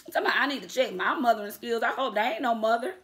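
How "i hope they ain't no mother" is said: normal talking voice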